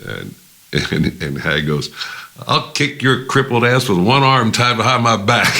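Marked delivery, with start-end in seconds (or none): 2.5-5.5 high, loud hammed-up threat, laughter breaking in; mock fighting challenge, pitched up and forceful; retold blustering threat. Hag threatening Blackburn in retold quarrel, laughing mock bluster.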